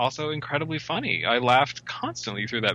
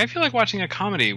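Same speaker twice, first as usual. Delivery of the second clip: higher-pitched, quick ribbing quote; Derek's teasing; 10 semitones higher, faster than usual. Retold or imitated. Friend ribbing her about comedies, teasing imitation. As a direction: imitate him needling you, pitched up and faster. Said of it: "ribs me because every time he's like".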